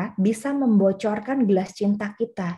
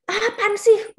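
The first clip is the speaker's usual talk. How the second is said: annoyed; 11 semitones higher, slower than usual. Retold or imitated.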